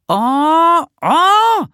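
very high, loud drawn-out cry; exasperated shout. A narrator's reading. Nisse despairs at Bertil's wrong words, shouting. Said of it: "schrie Nisse".

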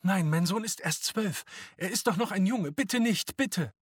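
high, loud pleading; mother's desperate cry. A narrator's reading. Mama begging the SA man for her son, desperate cry. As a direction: beg frantically for your child. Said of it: "schrie Mama".

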